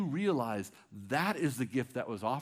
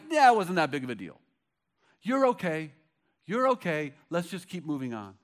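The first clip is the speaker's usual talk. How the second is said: breezy dismissive brush-off; 5 semitones higher, 9 dB louder than usual. Retold or imitated. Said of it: "He's not like".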